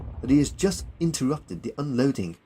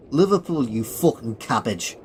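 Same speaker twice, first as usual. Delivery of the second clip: put-on Liverpool accent; 4 dB louder than usual. Billy insulting the Colonel, in a Liverpool accent. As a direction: correct him scornfully in a Liverpool accent. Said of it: "That explains the accent".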